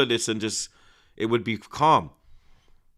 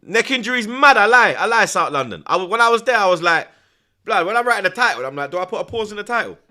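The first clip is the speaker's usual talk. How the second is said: much higher and louder, fast at the end; excited rant; 10 semitones higher, 9 dB louder than usual. Real, not acted. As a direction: rant about it all worked up. Excited ranting about the video title.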